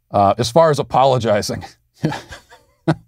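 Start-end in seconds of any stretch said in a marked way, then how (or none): 2.0-2.9 a short real laugh breaking through; can't keep a straight face, laugh it out. Real, not acted. Host laughing at the idea of apologizing to the media, short real laugh. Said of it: "I can't even say the word apology without laughing".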